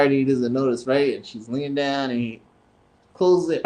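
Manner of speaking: ordinary talk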